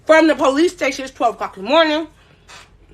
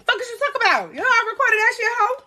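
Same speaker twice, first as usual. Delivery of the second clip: higher-pitched retold clapback; mock angry comeback; 8 semitones higher, faster than usual. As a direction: toss it back at her like you did back then. Retold fight with Sticks.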